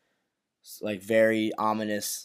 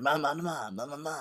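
softer, trailing mock-Italian babble; 4 semitones higher, 7 dB quieter than usual. Host mimics gangster saying something in Italian, quiet mock-Italian gibberish. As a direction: imitate Italian with nonsense syllables, fading away. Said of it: "something in Italian".

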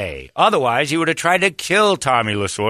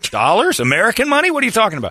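incredulous; 12 semitones higher, 5 dB louder than usual. Retold or imitated. Retold disbelief at fee demand.

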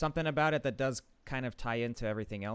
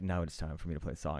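low sexy voice; 6 semitones lower, 4 dB quieter, faster than usual. Retold or imitated. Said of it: "he has this really low, like, sexy voice".

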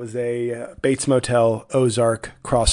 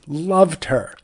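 ordinary talk, nothing marked

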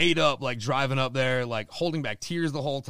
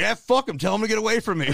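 louder, higher mock rant; retold yelling; 6 semitones higher, 5 dB louder, faster than usual. Dying dad yelling at the oxygen worker. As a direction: bark it at the nurse. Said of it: "yelling at this lady".

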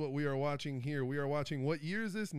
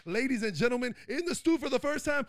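booming ring announcer, high and loud; hype announcer; 4 dB louder than usual. Acted. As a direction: shout the guest's intro over the music. Hyping guest intro with music.

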